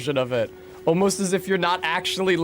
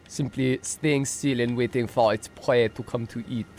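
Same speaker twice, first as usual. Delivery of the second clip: nature documentary. Acted.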